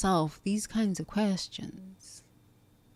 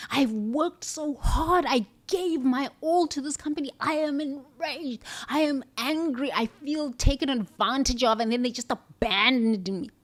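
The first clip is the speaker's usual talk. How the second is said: role-played rage; 6 semitones higher than usual. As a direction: play the furious employee venting at billionaires. Client role-played, voicing betrayal.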